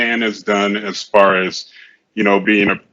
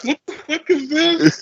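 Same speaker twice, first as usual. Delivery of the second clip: high-pitched shocked disbelief; shocked outburst. Retold or imitated. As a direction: blurt it out, appalled. Retold shock at the photo.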